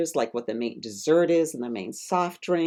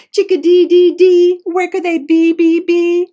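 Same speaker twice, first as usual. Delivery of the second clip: much higher, louder birdlike chirping; tiny bird singing its call, much higher; chickadee voice; 13 semitones higher, 13 dB louder than usual. Acted. Chickadee character sings its call.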